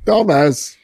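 "Dumbass" tried out as DAH-mez; stress on first syllable. Shown as a demonstration. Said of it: "now it is dumbass".

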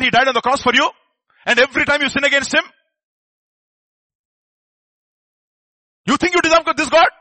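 loud accusing devil, building to a high shout. Acted. Devil accusing a believer of not deserving mercy, rising shout. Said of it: "That is the voice of the devil".

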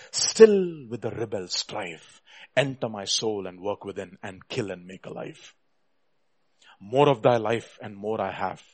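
low, measured and subdued; reverent hymn reading. Real, not acted. Preacher rereading Wesley's hymn for his brothers, reverent. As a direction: read it slowly as a quiet prayer.

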